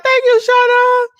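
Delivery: very high, loud, slow gushing thanks; excited thanks. Real, not acted. Streamer gushing thanks to Shauna again for the gift.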